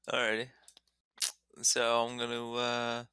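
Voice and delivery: ordinary delivery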